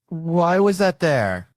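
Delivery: high, loud, drawn-out demand; mock interrogation. Detective grilling a suspect over a handkerchief, mock outraged. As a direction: stretch it out as a loud accusing question.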